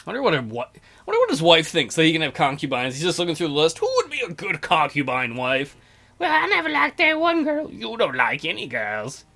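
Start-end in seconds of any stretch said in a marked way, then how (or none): 6.2-7.7 higher put-on voice; mock character voice. Ruler dismissing a bride choice, higher voice. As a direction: answer as the picky ruler.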